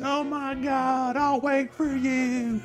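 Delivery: high, slow mock sobbing girlfriend; mock crying girlfriend. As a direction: ham up a girlfriend crying you'll be waited for. Crying in my face.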